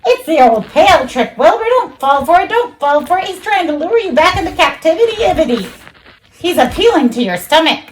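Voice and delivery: high, urgent goose voice. Acted. Goose warning Wilbur of the trick, high and urgent. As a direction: warn like a frantic goose.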